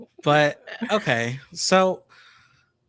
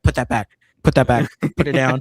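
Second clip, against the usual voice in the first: louder, quick mock command from production; retold curt order; 7 dB louder, much faster than usual. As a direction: imitate production barking an order.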